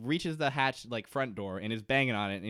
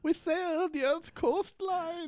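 slow, very high mock English; runaway Penny accent; much slower than usual. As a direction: draw it out as a grand English lady, way up high. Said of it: "this accent's really getting away from us".

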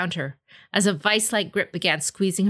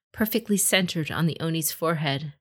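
usual speaking voice

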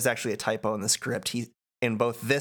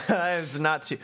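ordinary delivery

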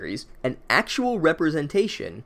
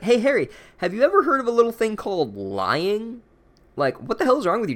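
much higher, incredulous scolding; exasperated; 9 semitones higher than usual. Acted. Narrator to Harry, exasperated about lying. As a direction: shout at Harry in disbelief.

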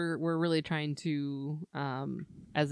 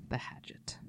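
whisper; 14 dB quieter than usual. Acted. Echoes the line in a whisper.

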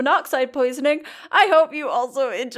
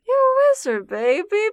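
teasing exclamation; 9 semitones higher than usual. Acted. Teasing a wizard player.

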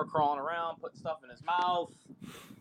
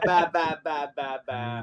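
imitated baby babble; 7 dB louder, slower than usual. Dad imitating his baby's babble.

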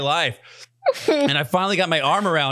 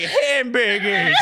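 drawn-out request; 7 semitones higher, much slower than usual. Retold or imitated. Dream Adam requesting food.

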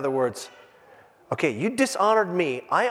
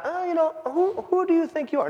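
mild version; 11 semitones higher than usual. Shown as a demonstration. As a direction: ask it timidly, no bite. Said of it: "It's not".

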